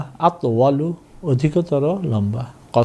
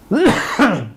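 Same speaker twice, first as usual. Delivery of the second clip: cough; 10 semitones higher, 4 dB louder than usual. Real, not acted. Cough mid-lesson.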